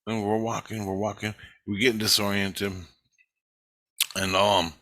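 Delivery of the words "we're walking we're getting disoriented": usual speaking voice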